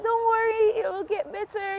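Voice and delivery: high, eager don't worry; reassuring. Retold or imitated. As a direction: give her hope, pitched high and bright. Trying to give her hope.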